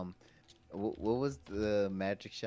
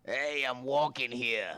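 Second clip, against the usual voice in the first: put-on slow, loud, painful old smoker; wrecked chain-smoking New Yorker; 5 semitones higher, 8 dB louder, slower than usual. Friend asks for a worse, more painful take, as wrecked New York smoker. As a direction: drag it out as if your throat is crumbling to ash. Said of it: "Like, more painful. You can feel the esophagus just crumbling to ash".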